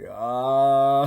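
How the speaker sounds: long, rising mock yell; mock scream. Retelling screaming back at his brother, drawn-out mock yell. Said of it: "I'm screaming at him at the top of my lungs".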